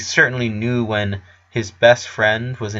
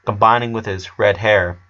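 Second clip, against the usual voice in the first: ordinary delivery, nothing marked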